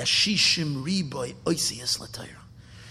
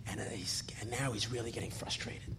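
hushed; 6 semitones lower, 14 dB quieter than usual. A narrator's reading. Storyteller hushing the tension.

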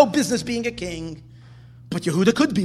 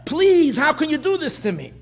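higher, louder desperate plea from the pit; pleading cry for help; 7 semitones higher, 6 dB louder than usual. Retold or imitated. Yosef in the pit to his brothers, a loud, desperate plea. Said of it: "Yosef is crying and screaming and pleading".

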